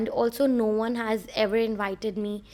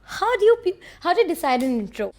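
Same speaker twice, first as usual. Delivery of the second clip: exasperated outburst; 7 semitones higher, 5 dB louder, faster than usual. Real, not acted. Frustrated at her own intro.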